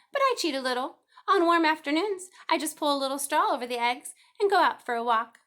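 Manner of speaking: high, loud, fussy goose voice, repeating herself; a busy mother goose clucking the time; chattering goose. A narrator's reading.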